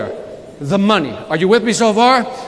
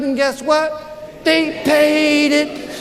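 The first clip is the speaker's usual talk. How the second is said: drawn-out, sing-song punchline; slow triumphant reveal; 4 semitones higher, much slower than usual. Acted. Repeating the punchline to the crowd, slow and sing-song. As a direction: savor the payoff slowly.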